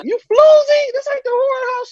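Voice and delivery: very high mock-screeching scold; hammed-up shrieking grandma; grandma snapping at the girl, very high. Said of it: "She was snapping".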